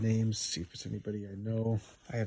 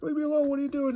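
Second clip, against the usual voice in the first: put-on high, squealing cry of a frightened girl; 6 dB louder, faster than usual. Girl grabbed by the villain cries out in a high squeal. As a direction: shriek it like a girl being grabbed. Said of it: "And she's like".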